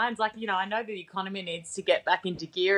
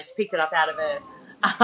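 ordinary talk, nothing marked

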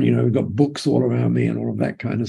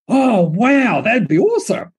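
high, loud mock-impressed bloke; big, hammed-up Aussie enthusiasm; 9 semitones higher, 4 dB louder than usual. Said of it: "My response was that of an Aussie bloke".

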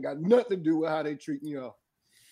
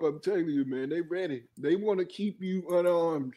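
ordinary talk, nothing marked

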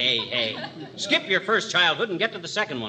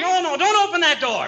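higher, louder, crying-out plea; frantic no; 11 semitones higher, 4 dB louder than usual. Acted. Husband stopping old-timer from opening the closet, frantic cry. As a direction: panic to stop him at the door.

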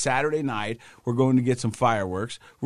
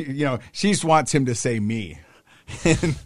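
ordinary talk, nothing marked

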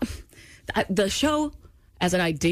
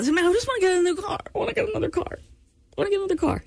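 much higher, whining for a car; whiny pleading; 13 semitones higher than usual. Real, not acted.

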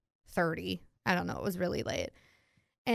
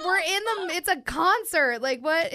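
higher, laughing protest; laughing disbelief; 7 semitones higher than usual. Retold or imitated. Telling a drunk friend it's a concert, laughing disbelief. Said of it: "I was like".

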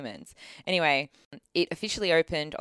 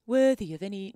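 higher, louder false start at posh English; 6 semitones higher, 5 dB louder than usual. Retold or imitated. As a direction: start the posh line too high. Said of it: "Let me try again".

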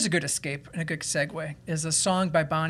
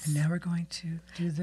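ASMR whisper. Retold or imitated. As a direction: coo it like a beauty-treatment ASMR video. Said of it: "Does she talk like this".

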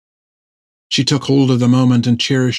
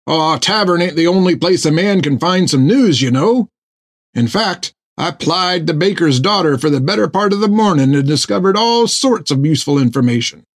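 higher, cheery boasting voice; eager sailor; 5 semitones higher than usual. A narrator's reading. Topper boasting to Jack about the baker's daughter, higher and cheery. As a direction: brag a little, chirpy.

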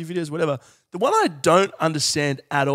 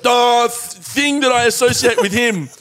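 higher, louder dawning thought; mock eager association; mock realisation; 7 semitones higher, 6 dB louder than usual. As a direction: act out a lightbulb moment. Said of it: "and he went".

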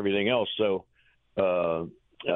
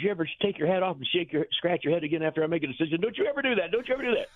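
mock telling-off, climbing much higher; retold scolding; 6 semitones higher, faster than usual. Manager scolds coach over head scratching, imitated rising rant. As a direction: imitate a boss scolding, rising to high-pitched warnings.

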